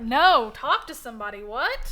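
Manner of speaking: loud, incredulous mock husband; scornful disbelief. Husband dismissing wife's wish to talk to somebody, scornful. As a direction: shoot the idea down in loud disbelief.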